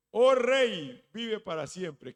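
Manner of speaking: loud, proclaiming hail to the king; loud respectful hail. Acted. Daniel hailing the king from the pit, loud and reverent.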